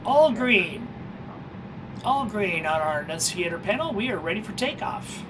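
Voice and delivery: ordinary talk, nothing marked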